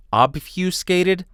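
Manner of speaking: "Obfuscated" drawn out slowly and high, as a question; questioning repeat. Real, not acted. Questions own word, repeating it.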